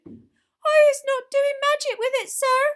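very high, loud, slow squeal; squealing elf. A narrator's reading. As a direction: squeal your denial as a terrified little elf. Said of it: "squealed Winky".

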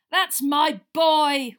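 loud, slow drawn-out yell. A narrator's reading. Wood yelling at Harry after the catch, loud. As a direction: yell it out in triumph.

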